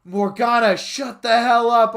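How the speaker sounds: snapping shout. Acted. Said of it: "I said".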